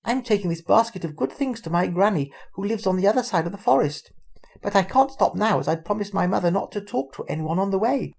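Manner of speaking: high, lightly voiced little girl; chatty young girl explaining. A narrator's reading.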